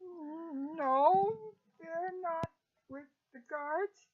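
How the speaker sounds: a reluctant little answer, rising at the end; nervous servant, softly unsure; timid cook. Acted. GM voices timid cook answering questions.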